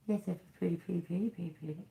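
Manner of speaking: high, fading to near silence; soft coaxing. Pretend. Coaxing bird softly.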